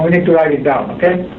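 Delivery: normal talking voice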